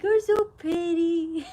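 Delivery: high, slow, besotted drawl; woozy gushing. Retold or imitated. Patient waking and complimenting someone, woozy gushing. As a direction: imitate yourself waking up smitten. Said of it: "I just wake up and I'm like".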